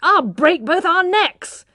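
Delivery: high, loud, slow furious outburst; high, loud, slow furious curse; furious swearing. A narrator's reading. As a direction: curse at her in a rage. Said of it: "he swore passionately".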